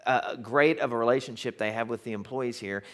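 ordinary delivery

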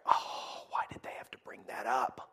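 whispered groaning complaint; exasperated whisper. Acted. Relative dreading the touchy topic, whispering in exasperation. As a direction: hiss it under your breath in dismay.

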